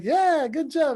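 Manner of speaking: high, encouraging dad cheer. Retold or imitated. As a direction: act out praising a small kid. Dad cheers son on slide.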